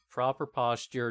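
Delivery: usual speaking voice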